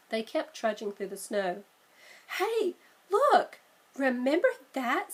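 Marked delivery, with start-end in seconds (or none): 2.4-5.1 high, loud hey look; excited call. Acted. Sister spotting the merry-go-round, excited and loud. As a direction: grab his attention.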